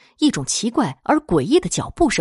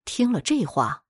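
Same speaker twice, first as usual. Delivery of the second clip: ordinary delivery, nothing marked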